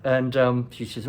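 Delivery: usual speaking voice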